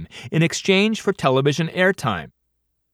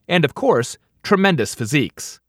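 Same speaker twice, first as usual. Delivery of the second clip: ordinary delivery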